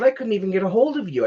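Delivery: high, fast, indignant complaint; heated rant. Retold or imitated. To the neighbour who vanished for months, fast indignant rant. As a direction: pile on the grievance, rushing. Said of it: "I got pretty heated".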